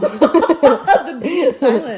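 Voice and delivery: loud slow laughing joke. Real, not acted. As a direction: laugh through the quip.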